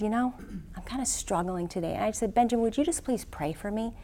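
soft, vulnerable admission; retold humble request, hushed; subdued plea. Retold struggling plea for prayer.

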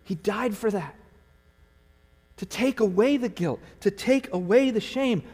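high, loud impassioned preaching; full-force preaching. Acted. Pastor urging his adrift church, impassioned preaching. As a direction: plead with the church to run to Jesus.

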